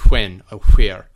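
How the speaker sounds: "when" and "where." with forceful breathy hw; Scottish hw starting "when" and "where."; fricative wh. Shown as a demonstration. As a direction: blow hard into the wh of "when" and "where.". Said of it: "He has kind of this fricative".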